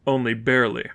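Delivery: ordinary delivery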